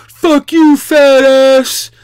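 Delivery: high, loud hammed-up yell; mock parting shout. Drunk guy yelling from his car while leaving, mock loud shout. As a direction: yell it from the car door like a sore loser. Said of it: "he goes".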